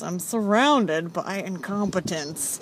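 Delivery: slow, lightly mumbled imitation of an awkward barista; a hint of a mumbling, blasé barista; mumbling coworker. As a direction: drawl it out like a deadpan grump. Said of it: "he mumbles a lot".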